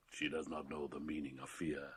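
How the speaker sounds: low aside. Acted. Father speaking of his daughter, soft low aside. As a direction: murmur it fondly.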